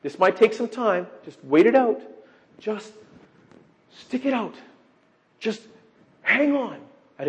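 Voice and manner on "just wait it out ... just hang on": higher, singsong mock consolation; mock cheap advice. Preacher mocking the shallow meaning of wait, higher and singsong. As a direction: parody a glib comforter. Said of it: "He is not saying".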